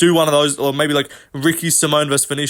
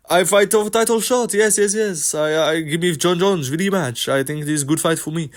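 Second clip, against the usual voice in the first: broken English in a mock French accent, pitched up; 2 semitones higher than usual. Mocking a fighter asking for a title shot in a mock French accent. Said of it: "and then he goes".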